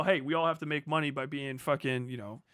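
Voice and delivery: usual speaking voice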